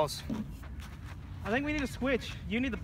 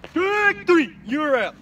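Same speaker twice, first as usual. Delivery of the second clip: umpire shout; 8 semitones higher, 8 dB louder, much slower than usual. Acted. Mock umpire call.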